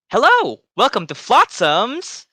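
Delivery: high, loud, eager shopkeeper welcome; cheery halfling greeting. Acted. Halfling shopkeeper greeting a customer who walks in, high and loud. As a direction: burst out a high, bright hello to the customer.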